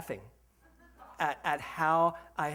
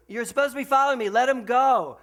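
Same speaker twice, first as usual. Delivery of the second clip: fast, raised call up the hill; calling out from afar; 7 semitones higher, 10 dB louder than usual. Retold or imitated. Guide calling down the hill to the rider, fast raised call. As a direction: shout instructions from a distance, hurried.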